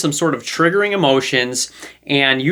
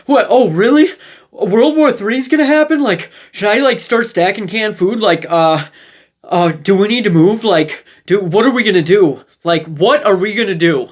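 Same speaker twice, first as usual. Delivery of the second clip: freaking out; 4 semitones higher than usual. Retold or imitated. Friend accepting the frame, hammed-up alarmed freak-out. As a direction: play the friend who catches the fear. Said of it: "person who's freaking out".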